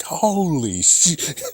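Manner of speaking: mock amazement. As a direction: stretch it out in imagined awe. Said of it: "Bungie will be like".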